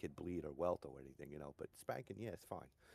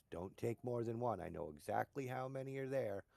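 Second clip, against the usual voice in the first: stern grandfather warning; 7 semitones higher, 5 dB louder than usual. Retold or imitated. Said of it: "and he goes".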